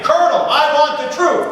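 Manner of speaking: second take of the cue, pushed a little harder; Tom Cruise line retried. Shown as a demonstration. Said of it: "Try it again".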